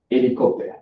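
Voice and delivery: HELICOPTER with the H dropped, elicopter; silent H. Shown as a demonstration. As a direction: say "Helicopter" the French way, vowel first. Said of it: "the H is silent".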